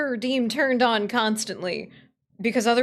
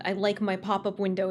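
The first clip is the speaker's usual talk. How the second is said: ordinary talk, nothing marked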